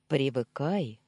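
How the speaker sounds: usual speaking voice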